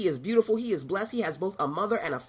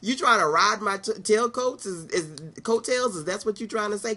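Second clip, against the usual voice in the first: incredulous sass; 5 semitones higher than usual. Retold or imitated. Speaker retorting to a friend riding her coattails. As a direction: call out the freeloader with attitude. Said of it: "that was a trigger for me".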